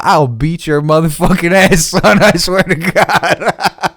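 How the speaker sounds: blustering, then laughing through the last words; laughing threat. Real, not acted. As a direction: make a threat you can't keep straight. Mock threat, breaking into laughter.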